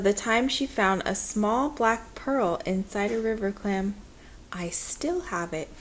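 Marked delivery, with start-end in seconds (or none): 4.5-5.7 whispered like a shared secret; secretive whisper. A narrator's reading. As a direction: breathe it as a confidence. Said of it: "she said".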